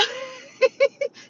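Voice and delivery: high, loud giggling burst; real helpless giggle, pitched high; laughing. Real, not acted. Laughs at her retold row.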